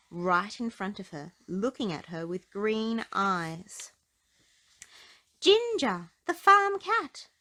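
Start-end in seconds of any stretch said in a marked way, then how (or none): 5.4-7.3 high, loud, slow announcement of danger; spring the reveal on the listener; dramatic reveal. A narrator's reading.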